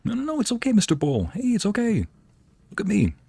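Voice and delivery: soothing high voice. Acted. To the frightened bull, a high soothing coo. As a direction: gently hush him like a spooked beast.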